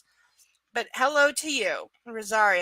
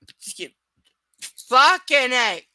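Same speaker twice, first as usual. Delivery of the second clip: hiccuping; 11 dB louder, slower than usual. Retold or imitated. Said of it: "with the hiccups".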